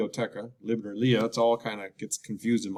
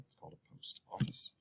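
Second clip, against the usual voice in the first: whispered; 4 semitones lower, 16 dB quieter than usual. Shown as a demonstration. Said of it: "whispered answer".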